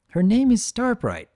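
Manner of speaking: high, bright filly voice; helpful filly. Acted. Filly introducing her shy friend, high and helpful. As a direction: say it high and helpful like a little girl. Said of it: "Firefly helpfully supplies".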